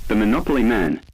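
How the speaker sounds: plain reading voice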